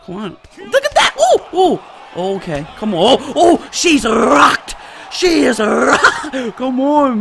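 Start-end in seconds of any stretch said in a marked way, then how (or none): 0.6-2.3 very high excited yelp; excited exclamation. Real, not acted. Gamer seeing a hit land, excited high yelp. 3.2-7.2 high, loud and stretched-out elation; excited shouting. Real, not acted. Gamer seeing opponent rocked, excited shout.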